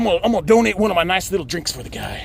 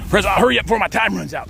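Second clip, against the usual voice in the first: normal talking voice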